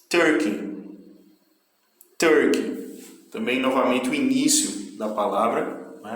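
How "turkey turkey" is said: TURKEY with force on the first syllable, then falling; stress on first syllable. Shown as a demonstration. Teacher drilling pronunciation for students, first syllable of Turkey stressed. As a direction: hit the start of "Turkey". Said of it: "A primeira sílaba tem mais força".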